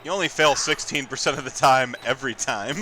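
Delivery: ordinary delivery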